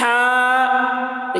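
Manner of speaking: ہا held long from the open throat; drawn-out letter name. Shown as a demonstration. Teacher shows students the letter ہا, drawn out. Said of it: "دیکھیے آواز".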